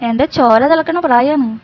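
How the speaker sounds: ordinary talk, nothing marked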